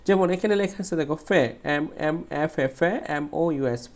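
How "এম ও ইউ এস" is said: normal talking voice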